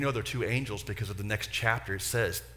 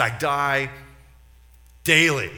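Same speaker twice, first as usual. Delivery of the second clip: emphatic quote; 3 semitones higher, 11 dB louder, slower than usual. Retold or imitated. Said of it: "Paul said".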